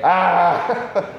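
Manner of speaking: a high, laughing yelp of mock panic; mock panicked yelp. Preacher mocks Jesus panicking on the cross with a laughing yelp. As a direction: yelp like a man losing it, played for laughs. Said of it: "He doesn't say".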